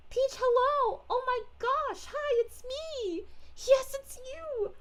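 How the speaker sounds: high, slow, drawn-out delighted squeal; excited greeting. Acted. Peach meets another Peach in the chat, gushing excited greeting. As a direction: greet your double with gushing, stretched-out delight.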